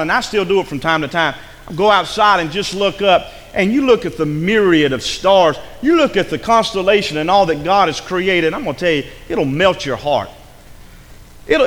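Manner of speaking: ordinary talk, nothing marked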